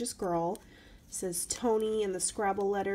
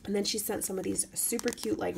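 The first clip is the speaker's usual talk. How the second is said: ordinary delivery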